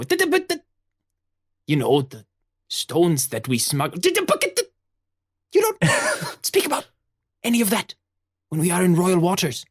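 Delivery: high, sputtering mock scolding; pirate mother scolding. Mother scolding her son over the smuggled stones, high and sputtering. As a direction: splutter at him, half laughing.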